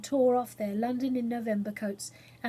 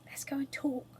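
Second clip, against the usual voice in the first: soft conspiratorial whisper; whispered aside; 3 semitones higher, 8 dB quieter than usual. Acted. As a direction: whisper so the cook can't hear.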